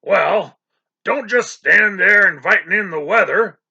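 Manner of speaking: loud booming sea captain; roaring. A narrator's reading. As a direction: roar it like a cannon. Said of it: "in a voice like the roar of a cannon".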